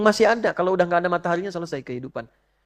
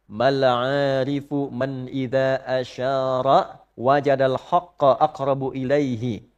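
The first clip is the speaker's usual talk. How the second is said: slow, chanted Quranic-style recital; Arabic recitation; 4 semitones lower, much slower than usual. Acted. Preacher reciting the text to the congregation, slow chant. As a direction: draw the Arabic out slowly and reverently.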